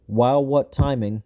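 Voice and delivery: ordinary talk, nothing marked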